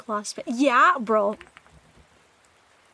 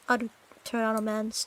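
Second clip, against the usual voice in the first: usual speaking voice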